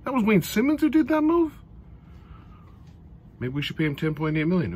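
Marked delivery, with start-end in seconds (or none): 0.0-1.5 mock astonishment, pitched way up; disbelieving shout. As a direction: act floored by the move. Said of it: "there's a couple times where I go".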